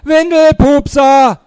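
slow, high, very loud jeer; full-force schoolyard mockery; yelled insult. A narrator's reading. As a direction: bellow each syllable as a taunt.

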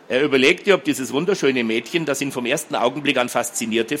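usual speaking voice

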